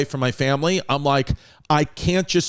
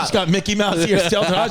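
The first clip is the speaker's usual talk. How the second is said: usual speaking voice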